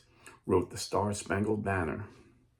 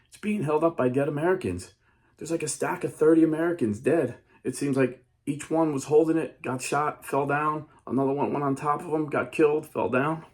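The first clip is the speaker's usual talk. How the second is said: shaken; 6 semitones higher, 4 dB louder than usual. Retold or imitated. First mate explaining, shaken.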